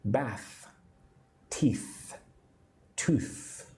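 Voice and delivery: soft, isolated "Bath", "teeth", "tooth" with lingering final th; teacher modelling the breath of th ending "Bath", "teeth", "tooth"; pronunciation demo. Shown as a demonstration. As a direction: say "Bath", "teeth", "tooth" one at a time, holding the th. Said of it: "for pronunciation?".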